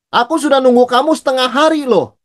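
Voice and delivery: lightly acted high exasperated complaint; exaggerated complaint. Preacher mimics a friend exaggerating how long they waited, mildly exasperated. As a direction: overstate the wait, half-playfully annoyed. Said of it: "saya kasih contoh".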